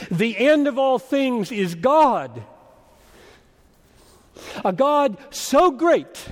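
ringing, emphatic sermon delivery; impassioned preaching. Real, not acted. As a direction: proclaim it from the pulpit with heavy emphasis. Preaches at full force.

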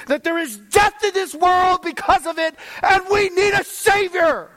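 loud, high, driving sermon voice; preaching at full force. Acted. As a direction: preach it hard, rising to a shout.

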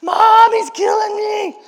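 high, loud, drawn-out scream for help; a little brother's staged shriek to get mom running; fake screaming for mom. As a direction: scream like he's really hurting you. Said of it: "I would start screaming as loud as I could".

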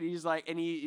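ordinary talk, nothing marked

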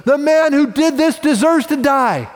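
high, loud furious sentence; the king's boiling fury; furious verdict. Retold or imitated. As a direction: spit out the death sentence in rage. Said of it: "And he's livid. His blood is boiling".

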